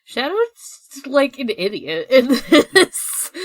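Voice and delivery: laughing. Real, not acted. Amused complaint about Shadow.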